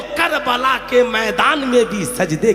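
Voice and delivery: impassioned preaching. Real, not acted. Preacher warning brothers, mothers and sisters, loud and impassioned. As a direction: drive the warning home phrase by phrase.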